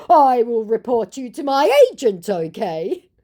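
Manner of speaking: high, hammed-up threat; mock-menacing warning; threatening scammer. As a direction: put on an indignant scammer, loud then dropping.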